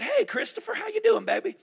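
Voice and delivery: high, sweet Southern grandma greeting; sweet Southern accent. Retold or imitated. Grandmother answering her grandson's call, high sweet Southern accent. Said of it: "a little sweet Southern sweet tea, fried chicken accent".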